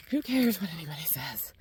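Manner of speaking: who cares, under the breath; dismissive whisper. Real, not acted. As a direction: drop to a whisper, shrugging it off. Dismissing the online arguing.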